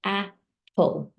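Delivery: AP, then PULL after a gap; Apple broken slowly in two, falling at the end; split into syllables. Shown as a demonstration. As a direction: sound out Apple as two separate beats. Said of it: "I'm doing it again".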